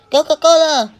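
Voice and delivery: high, loud gleeful cry; excited shout. Real, not acted. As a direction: yell it out in delight. Excited outburst in game.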